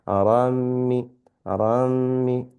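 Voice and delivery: rammi, doubled m held with a nasal hum; rammi with ghunna. Shown as a demonstration. Teacher drilling rammi, the mm held and nasal. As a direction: hold the mm in rammi with a slight nasal sound. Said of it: "We incorporate the nun sakin into the mim".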